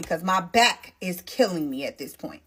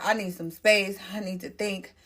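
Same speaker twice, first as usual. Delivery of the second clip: ordinary delivery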